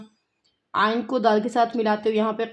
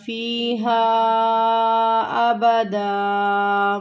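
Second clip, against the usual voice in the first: slow, drawn-out melodic tajweed recitation; Quran recitation; much slower than usual. Shown as a demonstration. Teacher recites the verse for her students, slow and melodic. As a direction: chant each word long and held.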